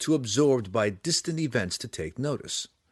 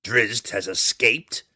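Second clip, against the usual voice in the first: slow, loud question with a touch of smugness; barely hidden smugness; 5 dB louder, slower than usual. A narrator's reading. Eldest daughter asking her furious mother, smugness barely hidden. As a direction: ask it while hiding satisfaction. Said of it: "trying to keep the smugness out of her voice".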